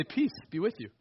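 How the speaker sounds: quiet, reverent peace be with you; soft solemn greeting. Retold or imitated. Preacher correcting how Jesus would greet, soft and solemn. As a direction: say it gently, hushed. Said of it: "wouldn't say it like that".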